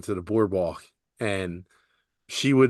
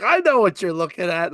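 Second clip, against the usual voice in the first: teasing grandma; 7 semitones higher than usual. Retold or imitated. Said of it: "She's like".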